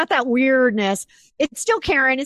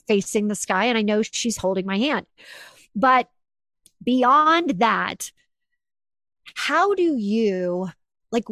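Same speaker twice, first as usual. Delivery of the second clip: ordinary delivery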